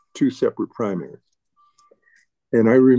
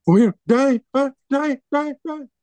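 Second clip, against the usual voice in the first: put-on childlike echo. Daughter echoing about dying.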